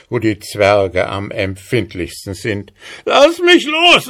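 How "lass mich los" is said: very high wailing dwarf voice; howling dwarf. A narrator's reading. Dwarf caught by the beard begs the horse, howling. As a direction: squeal to be let go. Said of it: "heulte der Zwerg".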